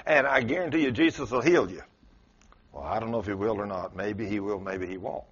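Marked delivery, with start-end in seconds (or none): 2.7-5.2 soft, unsure mumbling; doubtful shrug. Pretend. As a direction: sound like someone with no faith. Mocking a doubter's weak faith.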